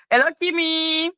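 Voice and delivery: high, held-out hello; singsong greeting. Real, not acted. Streamer greeting Kimmy as she joins the chat, singsong greeting. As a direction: lift the hello and hold the last vowel.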